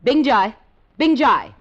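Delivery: loud call down the hall. Acted. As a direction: summon the guard loudly. Calling out for the guard.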